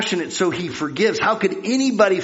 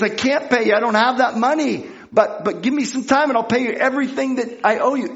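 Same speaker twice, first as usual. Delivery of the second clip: high-pitched, lightly desperate plea; acted pleading; 8 semitones higher than usual. Debtor begs the man at his throat, acted pleading. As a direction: plead for more time in a raised pitch.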